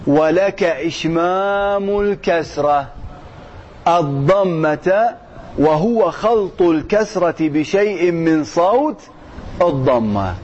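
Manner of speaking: slow, measured recitation of a grammar text; drawn-out, deliberate classical Arabic reading; formal text reading. Acted. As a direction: read it out slowly, stretching the key terms. Reads the text aloud.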